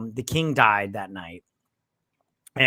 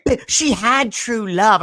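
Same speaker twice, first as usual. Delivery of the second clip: put-on loud, high booing old hag; old hag jeering at Buttercup, loud and high; 10 semitones higher, 8 dB louder, much slower than usual. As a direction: jeer like a crone at a crowd. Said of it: "this old hag lady comes out".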